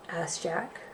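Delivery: ordinary delivery, nothing marked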